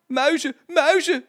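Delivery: high, loud cry of alarm; calling out. A narrator's reading. Jetje seeing mice burst out, calling out. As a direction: shout it out in alarm. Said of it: "riep Jetje".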